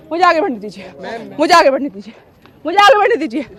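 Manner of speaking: ordinary talk, nothing marked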